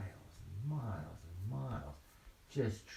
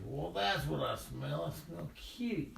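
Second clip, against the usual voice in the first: lightly voiced storybook bear making a find; bear discovering kitties; 9 semitones higher than usual.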